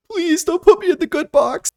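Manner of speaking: high-pitched begging wail; hammed-up squeal of dread; mock terrified plea. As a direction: beg in a put-on high voice. Acted plea of a frightened prisoner.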